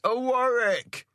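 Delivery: slow, high hail stretched across the water; a slow drawn hailing cry; drawn-out call. A narrator's reading. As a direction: stretch the call out long over the waves. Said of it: "he cries".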